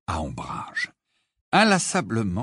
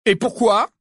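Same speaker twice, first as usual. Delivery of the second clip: angry; 10 semitones higher, 7 dB louder than usual. A narrator's reading. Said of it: "demanda Harry avec colère".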